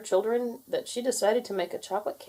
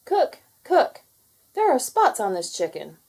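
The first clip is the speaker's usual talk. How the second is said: hen voice; 5 semitones higher, 5 dB louder than usual. Acted. Joke voiced by hens.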